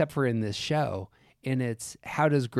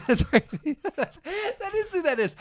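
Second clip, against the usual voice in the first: high laughing agreement. Real, not acted. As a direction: laugh through the words.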